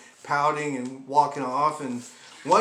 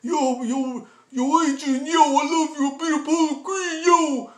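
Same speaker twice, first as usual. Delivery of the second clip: higher, lightly voiced movie tough guy; mock Rocky impression; 10 semitones higher, 4 dB louder than usual. Quoting a Rocky movie line in a higher mock voice. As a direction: ham up a boxer's slurred call. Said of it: "you know, like".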